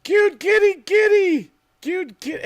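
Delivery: high, loud cooing to a cat; cat talk. Real, not acted. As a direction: call the kitty in a very high voice. Talking to his cat.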